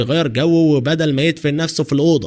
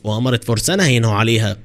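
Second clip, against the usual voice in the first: ordinary delivery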